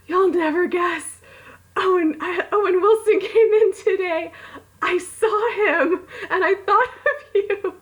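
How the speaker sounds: high-pitched, giddy retelling of a friend's text; excited friend. Retold or imitated. Friend texting about seeing Owen Wilson, imitated in high excitement. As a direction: gush it high, drawing out the big news.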